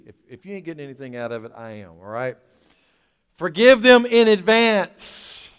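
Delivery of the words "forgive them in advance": slow, loud preached command; preaching at full force. Acted. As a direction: hammer the command out slowly.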